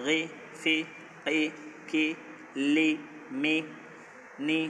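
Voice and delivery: short, clipped syllables, each set apart by a pause; reading demo. Shown as a demonstration. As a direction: read each syllable alone, beat by beat. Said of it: "রিডিংটা তোমরা এইভাবে করবে".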